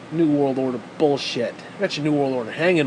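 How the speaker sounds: ordinary talk, nothing marked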